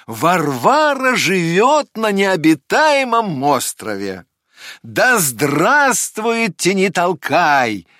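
joyful crowd cheer. Acted. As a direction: celebrate the news out loud. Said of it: "закричали они".

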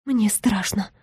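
forced out in a frightened whisper; strained whisper. A narrator's reading. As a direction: barely squeeze the words out. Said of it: "выдавила я из себя через силу".

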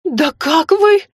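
высоко, громко, медленно возмущённо; захлебнуться возмущением; задыхаясь от негодования. A narrator's reading. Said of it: "задохнулась от негодования". (задыхаясь от возмущения)

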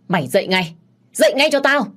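hét lên giận dữ, to và cao; người cha quát con gái dậy; quát lớn. A narrator's reading. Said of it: "quát lớn". (quát lớn giận dữ)

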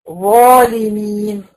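ظالمين slow with a full, heavy ظا; ظالمين drawn out, ظا fully heavy; heavy zaa. Shown as a demonstration. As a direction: stretch ظالمين with a thick ظا. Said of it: "الظا مفخمة".

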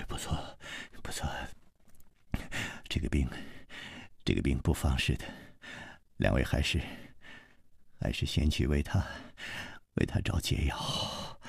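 very soft, low, faltering; weak, indistinct, shivering murmur; trembling sick voice. A narrator's reading. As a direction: force out feeble broken phrases through trembling lips. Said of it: "他的嘴唇已经抖得连话都说 不清楚了".

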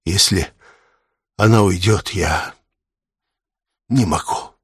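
halting, with long pauses; grief-stricken. A narrator's reading. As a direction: break off, unable to finish the thought. Man breaking down over dying woman.

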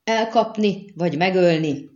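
high loud cry, easing at the end; screeched order. A narrator's reading. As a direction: screech a command to the men. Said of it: "rikoltotta Skellen".

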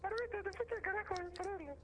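high squeaky little man; rat man voice. Pretend. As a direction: squeak the line like a tiny man. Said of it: "Es el hombre rata".

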